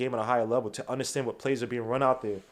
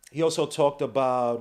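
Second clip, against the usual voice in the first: usual speaking voice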